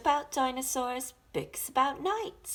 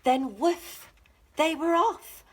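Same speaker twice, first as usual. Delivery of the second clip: louder, higher burst; excited woof; 6 semitones higher, 7 dB louder than usual. A narrator's reading. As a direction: launch into the chase. Dog bark, chase begins.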